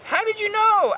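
very high hammed-up surprise; exaggerated, high-pitched surprised question. A narrator's reading. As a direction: squeal the question in mock astonishment. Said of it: "in a surprised way".

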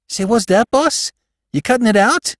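astonished crook, pitch shooting up; shocked disbelief. Acted. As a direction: question the boss as if stunned. Crook learns boss has retired.

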